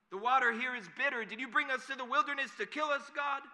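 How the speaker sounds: loud, high wail of complaint; hammed-up grumbling. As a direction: shout it accusingly, as a grumbling mob. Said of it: "the people start grumbling".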